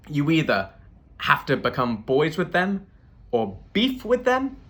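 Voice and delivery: slow, with a high rise on "them"; incredulous. Retold or imitated. Comedian questioning the Boston guy, incredulous rise. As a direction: ask it in disbelief.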